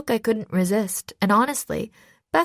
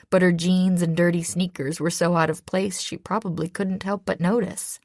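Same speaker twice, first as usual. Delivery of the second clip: usual speaking voice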